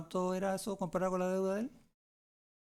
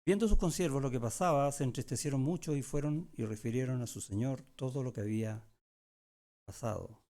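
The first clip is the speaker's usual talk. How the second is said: scripture reading; 2 semitones lower than usual. Acted. Pastor reads Matthew's parable to the congregation, a raised, deliberate reading. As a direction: read the verses out to the flock.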